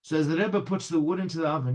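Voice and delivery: usual speaking voice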